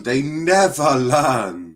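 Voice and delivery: stretched slowly, mock weary; a fed-up sigh of a line; slow, drawn-out lament. Real, not acted. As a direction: drag each word out in disbelief. Repeating his frustrated verdict.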